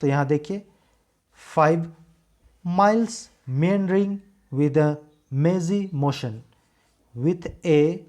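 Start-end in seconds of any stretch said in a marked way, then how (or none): 1.6-6.4 very slow, word by word, with MILES, MEANDERING, MAZY, MOTION stressed; stressed syllables. Shown as a demonstration. Teacher shows students which words carry stress, stressed syllables. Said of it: "बलाघात यानी stress पड़ रहा है".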